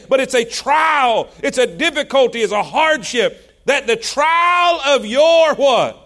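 rising, pounding pulpit preaching; preaching at full force. Acted. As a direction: drive the verse home to the congregation. Preacher builds over scripture.

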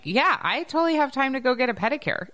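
mock carefree self; breezy. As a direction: toss it off like nothing matters. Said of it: "I'm like".

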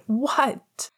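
high, startled "what" at normal volume; mild shout. A narrator's reading. James hearing that Lily's water broke, mild shout. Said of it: "James shouted".

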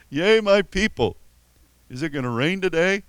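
mock-solemn prophecy tacked onto small talk, high and loud; goofy prophetic voice. Shown as a demonstration. Pastor mocking a canned prophetic phrase, hammed-up pulpit voice. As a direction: ham up the holy preamble, then ask about the weather. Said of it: "Just kinda goofy".

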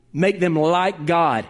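usual speaking voice

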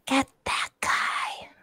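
slow breathy whisper in the ear. Acted. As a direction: hiss it slow into his ear. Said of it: "can we just whisper something".

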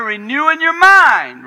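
high, loud, slow proclamation of the text; loud emphatic reading. Shown as a demonstration. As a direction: belt out the verse, slow and high. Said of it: "it says right here".

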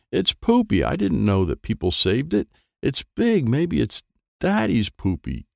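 lightly voiced excited little girl; a high, curious kid's voice. A narrator's reading. Mary voiced in read story.

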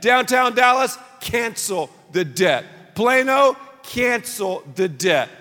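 "Cancel the debt" drawn out slowly for the crowd to repeat; slow call and response. Shown as a demonstration. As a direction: lead the room in it slowly. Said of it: "Say it with me".

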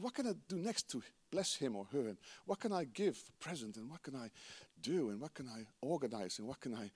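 dreamy private wondering, hushed; quiet inner musing. Retold or imitated. Lover's inner thoughts voiced.

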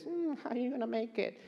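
soft sneering taunt; hint of the mocking enemy voice; the enemy's taunting voice. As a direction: tease them like a sly tempter. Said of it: "when the voice comes and says".

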